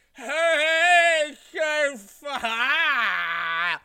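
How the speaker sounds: very high, loud, boozy "hey, hey"; loud drunk greeting. Retold or imitated. Blake, drunk on Jim Beam, loudly greeting her. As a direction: bellow it like a drunk mate barging in.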